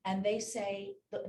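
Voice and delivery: ordinary talk, nothing marked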